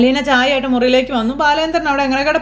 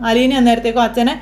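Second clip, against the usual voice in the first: usual speaking voice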